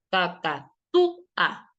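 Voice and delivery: "Cacatúa" drawn out slowly, with the "tú" punched; slow teacher's demo of "Cacatúa", strong beat on "tú"; stress on TÚ. Shown as a demonstration. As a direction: hit the "tú" in "Cacatúa" hardest, syllables held apart. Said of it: "¿Dónde lleva la mayor fuerza de voz?".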